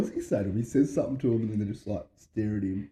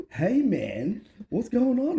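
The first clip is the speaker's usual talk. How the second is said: higher and louder, hammed-up chirpy hello; cheery greeting; 12 semitones higher, 5 dB louder, much slower than usual. Theo retelling his cult days, mock cheery greeting. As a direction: sell it like a bubbly cult recruit. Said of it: "He's like".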